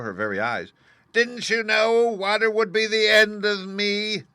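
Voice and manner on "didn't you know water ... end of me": very high, loud, slow wail; wailing, desperate. A narrator's reading. Melting witch wailing desperately at Dorothy. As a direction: wail it out in despair. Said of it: "in a wailing, desperate voice".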